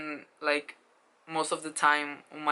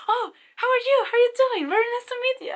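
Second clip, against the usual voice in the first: very high, loud excited greeting; 6 dB louder, faster than usual. Retold or imitated. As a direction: gush a hello way up high. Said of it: "he was so excited".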